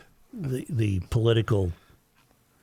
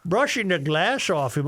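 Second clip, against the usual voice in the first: normal talking voice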